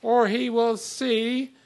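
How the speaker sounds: or he will see, slow and loud, then a break; loud, slow setup that ends on see; pause after see. Shown as a demonstration. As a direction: drag out or he will see and hold before going on. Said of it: "comma, my son".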